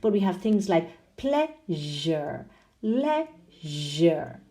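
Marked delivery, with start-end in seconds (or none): 1.2-4.4 "pleasure", "leisure" split, with a long "zh"; teacherly "ple-zhure, lei-zhure"; exaggerated ZH. Shown as a demonstration. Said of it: "Exaggerating slightly so you can hear".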